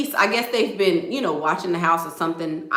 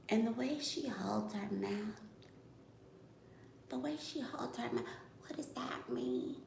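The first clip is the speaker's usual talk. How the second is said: much softer disbelieving mutter; 20 dB quieter than usual. Real, not acted. Complaining about Beth's mouth to viewers, soft mutter. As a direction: mutter it under your breath.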